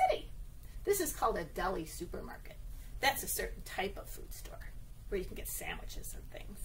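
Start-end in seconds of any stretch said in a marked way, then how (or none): 3.0-6.6 breathy hushed explanation; quiet whisper. Acted. Teacher quietly explaining the deli to the children, whispered. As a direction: whisper it like a cozy aside.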